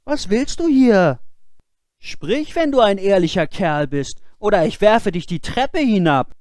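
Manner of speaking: put-on high, loud boyish threat; shouting threat. Boy calling a second time to the figure, loud and threatening. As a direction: threaten to throw him down the stairs. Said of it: "Der Junge rief zum zweiten Mal".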